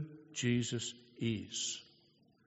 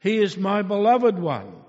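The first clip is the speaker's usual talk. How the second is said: louder, proud declaration; voice of God; 4 semitones higher, 8 dB louder than usual. Acted. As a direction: proclaim it with fatherly pride. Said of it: "he said".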